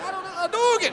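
high, panicked-sounding blurt; comic high-pitched outburst; yelled out. Retold or imitated. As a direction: blurt a game battle cry in a high voice. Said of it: "And I just yelled it out".

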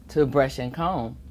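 ordinary delivery, nothing marked

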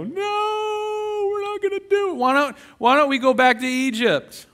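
very high, whiny refusing voice; whiny Israelites. Pretend. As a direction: whine it like a stubborn child. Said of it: "And they go".

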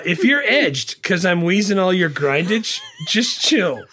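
loud, laughing read-through; barely holding it together; reading through laughter. Real, not acted. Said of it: "read it in a straight voice 'cause I'm not gonna be able".

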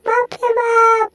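high, squealing cartoon voice; excitable cartoon character.

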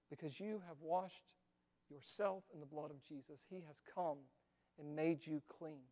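soft preacher's comfort; hushed tender assurance. Acted. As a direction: hush it like a comfort. Tender promise of cleansing.

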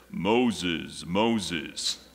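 low, slow, booming God calling Moses. Acted. Preacher doing God's voice calling Moses from the bush, low. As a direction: call his name low and solemn, like God from the bush. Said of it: "It's my God voice".

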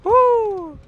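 high whooping cheer; excited cheer. Real, not acted. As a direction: cheer out in triumph. Escaping, cheering.

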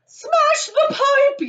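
very high, slow, drawn-out yell; yelled command. A narrator's reading. Mother orders pipe smashed to free son, yelling. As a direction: yell it shrieking, stretching each word. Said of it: "yelled Missus Gloop".

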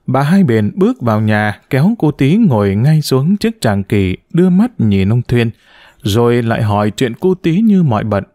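ordinary delivery, nothing marked

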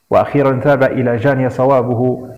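usual speaking voice

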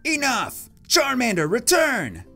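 high, forceful recall; rival trainer's command. Acted. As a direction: call it back sharply in a high voice. Rival calls back his Pokemon.

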